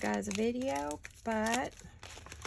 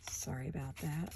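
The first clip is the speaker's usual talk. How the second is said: ordinary talk, nothing marked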